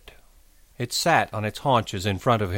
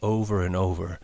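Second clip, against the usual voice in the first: ordinary delivery, nothing marked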